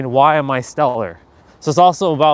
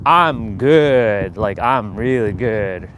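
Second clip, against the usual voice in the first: low, slow, heartfelt; genuinely good; 5 semitones lower, much slower than usual. Shown as a demonstration. Showing someone who really is good, low slow sincerity. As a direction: savour it as if you truly mean it. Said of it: "unless they say it in a term like".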